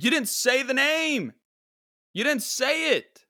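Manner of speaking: high, loud exasperated complaint. Real, not acted. Host scolding Mama June for skipping the name, high and loud. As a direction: groan it out in disbelief.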